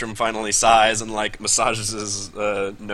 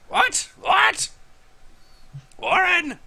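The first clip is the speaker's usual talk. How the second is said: put-on cranky old halfling; slower than usual. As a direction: shout like a wizened miser who barges in. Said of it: "wizened old halfling".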